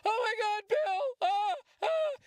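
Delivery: put-on high-pitched, squealing little kid fan. Kid fan begging Bill to sign, high squeal. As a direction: gush like an overexcited kid begging for an autograph. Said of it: "It was all an act".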